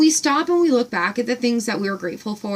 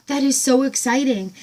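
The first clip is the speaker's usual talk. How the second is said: retold excitement; 3 semitones higher than usual. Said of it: "and I'm like".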